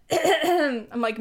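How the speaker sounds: throat-clearing, voice catching; hoarse voice. Real, not acted. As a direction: voice breaking up. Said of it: "I think I'm losing my voice".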